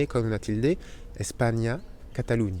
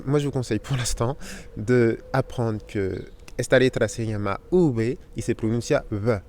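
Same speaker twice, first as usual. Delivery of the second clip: normal talking voice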